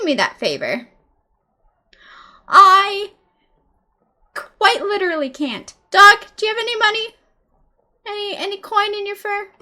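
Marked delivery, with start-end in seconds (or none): 2.5-5.7 loud emphatic refusal; shouted refusal. Acted. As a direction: shout it back at him. Refusing the bartender's request.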